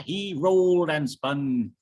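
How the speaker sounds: plain reading voice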